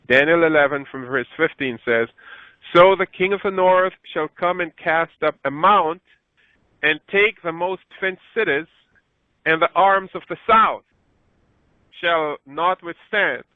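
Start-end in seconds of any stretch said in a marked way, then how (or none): 2.7-13.4 raised, measured scripture reading; solemn Bible reading voice. Acted. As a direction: read the verse out like Scripture. Reads Daniel eleven aloud.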